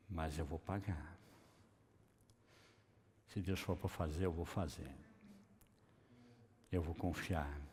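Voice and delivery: low, hushed self-assurance; quiet resolve. Acted. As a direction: murmur it to yourself, low and determined. Voicing quiet resolve to trust. (determinação em voz baixa e grave)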